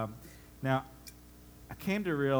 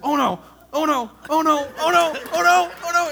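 squeals rising higher and louder each time; building mock panic; 13 dB louder than usual. Dad watching the board wiggle loose, escalating hammed-up squeal. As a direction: ramp up the panic with every oh no. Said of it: "he's like".

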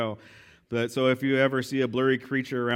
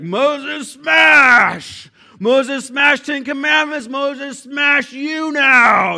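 very high, loud caveman Hulk shout; comic Hulk-Moses shouting in third person; 12 semitones higher, 12 dB louder, much slower than usual. Acted. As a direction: smash it out like the Hulk, broken grammar. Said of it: "not the Bruce Banner of the Bible".

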